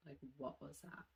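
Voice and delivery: very soft, wary question; hushed alarm. Retold or imitated. Woken by noise in dark room, hushed wary question. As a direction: ask it under the breath.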